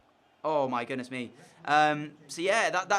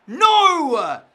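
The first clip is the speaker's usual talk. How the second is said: much higher, much louder despairing cry; anguished shout; 11 semitones higher, 13 dB louder than usual. Real, not acted. Gamer watching Chelsea score, anguished shout. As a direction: let out a real groan of despair. Conceding a goal.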